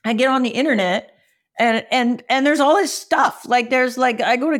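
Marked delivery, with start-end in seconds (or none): none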